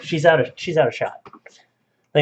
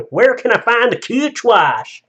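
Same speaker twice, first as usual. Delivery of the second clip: high, loud, hammed-up Southern drawl; loud Southern accent; 10 semitones higher, 6 dB louder, slower than usual. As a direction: belt out a slow, exaggerated Southern accent. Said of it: "One said in a loud Southern, uh, accent".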